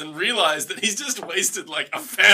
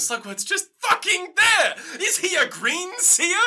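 very high-pitched comic rant; exasperated disbelief; 12 semitones higher than usual. Real, not acted. Host rants to co-host about Slugworth appearing, incredulous. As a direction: build it as outraged rhetorical questions.

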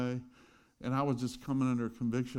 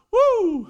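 excited whoop; 16 dB louder than usual. Pretend. Mock earthquake excitement.

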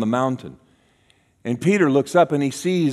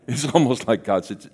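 ordinary delivery

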